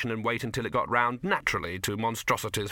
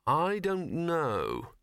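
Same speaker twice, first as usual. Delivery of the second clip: drawn-out, higher-pitched answer; slow; 7 semitones higher, much slower than usual. A narrator's reading. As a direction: stretch the words out slowly. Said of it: "said Victor slowly".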